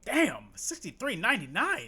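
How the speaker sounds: stretched-out amazed exclamation; exclaimed shock. Real, not acted. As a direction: react to a shocking price, slowly. Reacting to an expensive Uber price.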